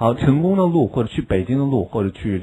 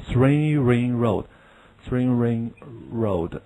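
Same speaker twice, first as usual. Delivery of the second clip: slow model reading; 4 dB quieter, much slower than usual. Shown as a demonstration. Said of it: "teacher models phrase".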